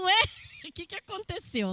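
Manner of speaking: high-pitched mock bewilderment with a laugh; puzzled reaction. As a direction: play a baffled onlooker, amused. Acting out a puzzled congregation. (espanto fingido, voz aguda e risonha)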